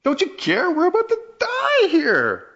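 terrified plea. Acted. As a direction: beg him like you're drowning. Disciples cry out in storm.

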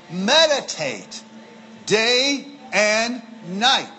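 meditate, day and night drawn out slowly; slow, deliberate reading. Shown as a demonstration. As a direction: read it word by word, savoring it. Reads verse slowly for emphasis.

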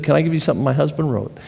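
rattled off fast and simply; just blurt the offer quickly; quick plain ask. Retold or imitated. Wife handing out tracts, said fast and plainly. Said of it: "she just said".

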